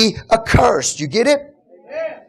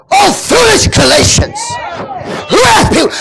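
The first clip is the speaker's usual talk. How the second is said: breathing fire; 9 semitones higher than usual. Shown as a demonstration. Preacher showing how Paul rebukes the Galatians, fiery. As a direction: blast it like a man on fire. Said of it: "he's breathing fire".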